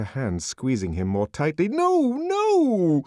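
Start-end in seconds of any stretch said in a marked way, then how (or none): 1.7-3.0 very high, loud pleading no; frightened plea. A narrator's reading. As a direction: desperately beg him to stop. Linda pleading.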